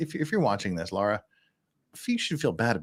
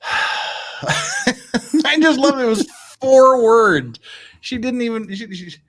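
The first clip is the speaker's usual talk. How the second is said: laughing; 11 semitones higher, 9 dB louder, slower than usual. Real, not acted. Laughing at the ruling.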